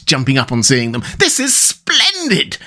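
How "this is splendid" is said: very high, drawn-out delight; effusive storybook welcome; delighted exclamation. Acted. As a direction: stretch it out high in glee. Toad delighted to see visitors.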